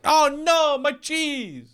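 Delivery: squeaky high cry of dismay; mock cheese owner wail. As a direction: shriek like a cartoon losing his cheese.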